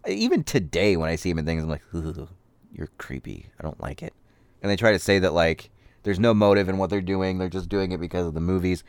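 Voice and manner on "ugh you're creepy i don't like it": creeped out. Retold or imitated. Reacting to creepy actor.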